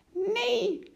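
high-pitched cry of refusal; acted high outcry. A narrator's reading. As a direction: call it out in panicky protest, way up high. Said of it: "riep de ruiter".

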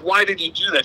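ordinary talk, nothing marked

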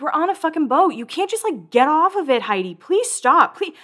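high-pitched, hammed-up exasperated begging; exasperated pleading. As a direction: plead high, imitating fed-up friends. Acts out everyone pleading with Heidi.

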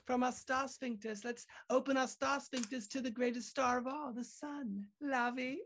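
very high English accent; Russell Brand. Shown as a demonstration. Host raising the impression on request, high English accent. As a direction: pitch it right up as a cheeky English comic. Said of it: "make it a little bit higher".

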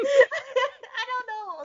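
high, slow words through laughter; drawn-out laughing shrug; laughing sheepishly. Real, not acted. Laughing at being caught out.

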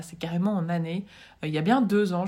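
ordinary talk, nothing marked

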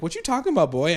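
high sassy retort. Retold or imitated. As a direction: throw it back with sass. Girlfriend voiced, sassy retort.

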